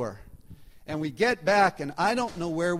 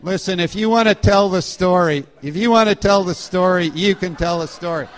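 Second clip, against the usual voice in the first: loud, playful telling-off; insistent, comically indignant rebuke; mock scolding; 9 dB louder than usual. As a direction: put a heckler in their place for laughs. Playfully rebukes someone correcting his story.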